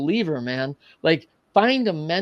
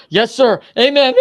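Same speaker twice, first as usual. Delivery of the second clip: high, loud burst of joy; thrilled yes sir; 8 semitones higher, 9 dB louder than usual. Retold or imitated. Teen told he has what it takes, high loud elated. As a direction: shout it like you just got the answer you prayed for.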